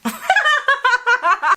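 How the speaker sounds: high-pitched burst of laughter; laughing. Real, not acted. Cracking up at own attempt at acting, laughing.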